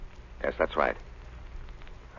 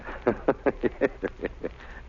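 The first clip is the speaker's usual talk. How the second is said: soft breathy satisfied laugh; quiet sly chuckle; 4 dB quieter than usual. Acted. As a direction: chuckle to yourself after hanging up. Warden chuckles after phone call.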